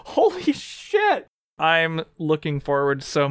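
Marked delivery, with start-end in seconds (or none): 0.1-1.3 rising, thrilled, nearly squealing; excited. Real, not acted. Thrilled about parents' secret plot.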